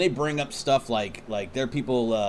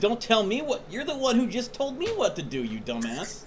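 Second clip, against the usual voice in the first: high-pitched mock indignation, dropping at the end; acted exasperated retort, falling off on the insult; indignant comeback; 10 semitones higher than usual. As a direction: throw it back in a high, mocking voice.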